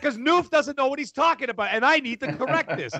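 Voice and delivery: defensive reply. Retold or imitated. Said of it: "I said".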